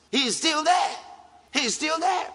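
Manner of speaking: preacher insisting God remains; shouted insistence. Acted. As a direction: proclaim it over the congregation.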